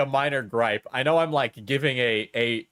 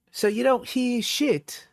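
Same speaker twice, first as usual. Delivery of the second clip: much higher, whiny parody of her scolding; mocking high girly voice; 12 semitones higher, slower than usual. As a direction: sneer it in a high mimic. Reads her messages in mock voice.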